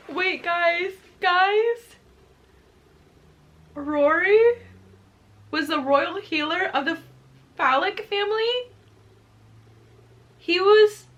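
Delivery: high, loud crying voice; tearful, overwhelmed. Real, not acted. Reader telling viewers a family reveal, tearful and stunned. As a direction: wail it out in disbelief, haltingly. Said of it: "the tears have already started".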